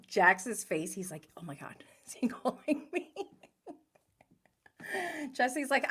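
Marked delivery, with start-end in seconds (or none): 1.4-3.1 fast, whispered with a laugh breaking in; whispered disbelief. Retold or imitated. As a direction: gasp it out, half laughing. Jax reacting, being called.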